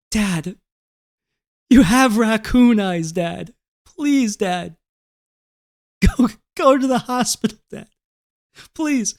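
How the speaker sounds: high, pleading mock sister voice; tearful pleading. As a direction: imitate a daughter begging near tears. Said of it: "she's literally borderline tears, pleading".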